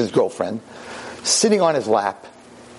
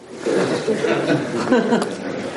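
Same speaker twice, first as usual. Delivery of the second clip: breathy laugh; 6 semitones higher than usual. Real, not acted. Rabbi laughing at frustrating Scott, airy chuckle.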